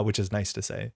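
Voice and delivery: ordinary talk, nothing marked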